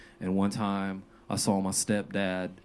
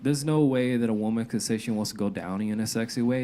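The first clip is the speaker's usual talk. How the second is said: normal talking voice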